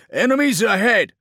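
very high, loud alarm; urgent warning shout. Acted. Driver warning captain of ambush, urgent shout. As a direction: yell the danger to your commander. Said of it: "voxed the driver".

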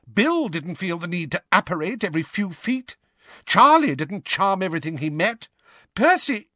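high, indignant tirade breaking off; raging. A narrator's reading. Mother listing better-behaved brothers to the twins, raging. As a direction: build up the rebuke, then stop dead. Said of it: "Missus Weasley raged at the twins".

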